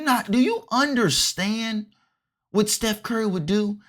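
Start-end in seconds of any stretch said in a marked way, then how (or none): none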